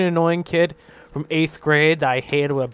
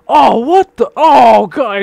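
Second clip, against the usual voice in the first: loud, high retold gag at the smell; disgusted outcry; 8 semitones higher, 12 dB louder, much slower than usual. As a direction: shout out in disgust. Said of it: "everyone's like".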